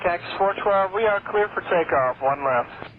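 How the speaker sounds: pilot over a crackly radio; air traffic control radio. Real, not acted. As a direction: narrowband cockpit radio transmission. Pilot reads back over radio.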